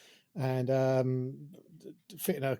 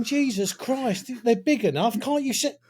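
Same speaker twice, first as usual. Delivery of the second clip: exasperated mum; 8 semitones higher, 3 dB louder than usual. Retold or imitated. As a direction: scold like a fed-up mum. Mother scolds the kid.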